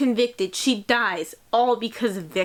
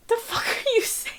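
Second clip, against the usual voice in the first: high, exasperated whine; 10 semitones higher, 3 dB quieter than usual. Real, not acted. Reader fed up with Frankenstein's endless talk, exasperated whine. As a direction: whine it in disbelief at the book.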